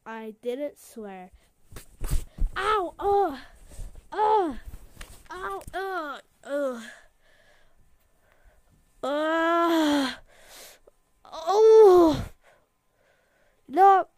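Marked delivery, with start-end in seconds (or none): 2.6-14.1 pained yelps. Acted. Hurt, yelling.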